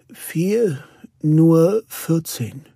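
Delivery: voice breaking. A narrator's reading. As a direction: force it out slowly, choking up. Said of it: "sagte sie mit brüchiger Stimme". (mit brüchiger Stimme)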